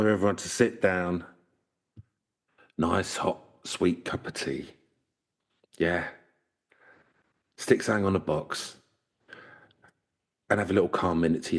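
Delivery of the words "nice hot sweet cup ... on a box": usual speaking voice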